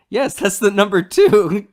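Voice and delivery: proudly wrong answer; earnest old man. Retold or imitated. Old man answers Zorro, loud and earnest. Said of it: "And he goes".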